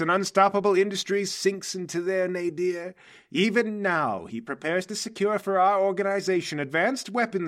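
scheming mastermind unveiling the plan, rising and falling theatrically; Mercury, grand villain boss. Acted. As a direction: orate grandly to a trusted henchman. Game master plays Mercury.

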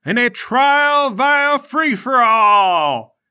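high, drawn-out arena announcer shout; proclaiming official. Acted. Official announcing the trial to the crowd, grand proclamation. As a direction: build to a big, stretched finish. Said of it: "An official comes out and says".

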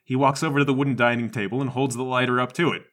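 ordinary delivery

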